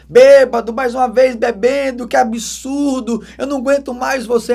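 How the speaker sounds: loud, high, exasperated nagging; hammed-up fed-up wife yelling at her drunk husband; mock furious rant, slowing on que absurdo; imitated angry scolding. Wife's imagined scolding of drunk husband.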